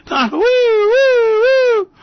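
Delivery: very high, loud, slow woo-hoo cries; gleeful whooping. Real, not acted. Host whooping over Trump's trouble, loud high glee. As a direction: whoop like a fan at a win.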